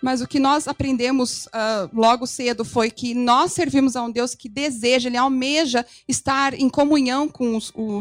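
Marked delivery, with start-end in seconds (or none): none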